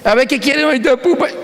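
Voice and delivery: put-on high-pitched mother talking to a toddler; imitating his wife. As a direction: put on a high voice, asking the little one. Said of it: "Por fin llegó mi mujer".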